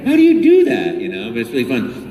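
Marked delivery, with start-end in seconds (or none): 0.0-1.0 high, loud disbelief; astonished. Retold or imitated. Amazed at how the nieces beat the game, high and loud. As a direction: marvel at the nieces' skill. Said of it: "I'm like".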